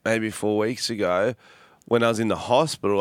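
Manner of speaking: usual speaking voice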